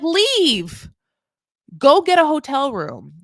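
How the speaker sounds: high, loud mock command; acted fed-up dismissal; exasperated order. Imagined telling camping couple to go.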